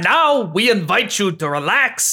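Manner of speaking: grand maître d' intro in a hammed-up French accent; bad French accent. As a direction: present it like a hokey French host. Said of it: "The bad French accent really got away".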